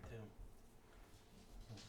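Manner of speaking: ordinary talk, nothing marked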